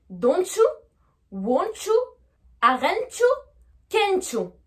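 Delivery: DON'T YOU as "donchu", likewise the others; fuse each T and YOU into "chu"; linked with CH. Shown as a demonstration. Teacher showing the English link, YOU said as chu. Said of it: "rajouter entre les deux le son C H".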